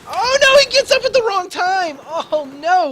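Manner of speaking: high, loud shocked cry; excited shout. Real, not acted. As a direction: yell it out as the play goes wrong. Player misses a big play.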